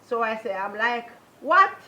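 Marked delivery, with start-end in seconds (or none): 1.4-1.8 a sudden high, loud what, stunned; shocked what. Retold or imitated. Hearing her partner plans to kill her, shocked cry. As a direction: blurt it out in disbelief.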